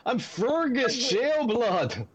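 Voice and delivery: high, slow would-be Irish dwarf; dwarf accent. Acted. Freed dwarf introduces himself to his rescuers, in a high dwarf accent. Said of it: "Your Irish accent needs help".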